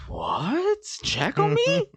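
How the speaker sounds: incredulous falsetto echo; mock disbelief. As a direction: voice leaping very high at the end. Retold disbelief at ex's excuse.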